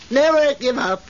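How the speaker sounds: high, loud triumphant proclamation; ringing high motto; proclaimed motto. Acted. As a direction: declare it like a banner slogan. Hammy proclamation of his motto.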